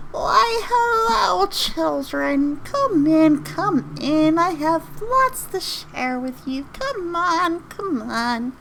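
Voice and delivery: high-pitched, hammed-up old lady voice. Shown as a demonstration. Game master's old lady NPC beckoning children inside, high old-lady voice. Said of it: "old lady N P C always goes something like".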